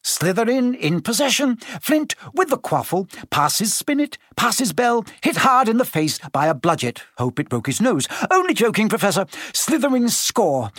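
lightly voiced quidditch commentator; match commentary. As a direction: rattle off the play like a biased schoolboy announcer. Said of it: "Lee was still commentating".

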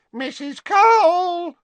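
loud, high, drawn-out call; bellow. A narrator's reading. Scruffy girl summoning the matron over her shoulder, bellowing. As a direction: bellow the name to someone far inside. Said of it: "she bellowed over her shoulder".

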